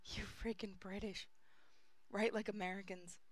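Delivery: whispered, annoyed; whispered curse. Real, not acted. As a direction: hiss it under breath. Muttered curse at author.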